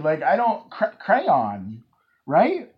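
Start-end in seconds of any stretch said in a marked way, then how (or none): 0.7-1.8 "crayon" as cray-on, attempted carefully; two-syllable crayon. Shown as a demonstration. Host struggling to demonstrate, "crayon" as cray-on. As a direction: try "crayon" with a clear on. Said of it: "now that I'm doing it, it's hard".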